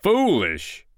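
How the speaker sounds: high, indignant giant outburst; storybook giant's affronted roar. A narrator's reading. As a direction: burst out in offended disbelief. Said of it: "roared the giant".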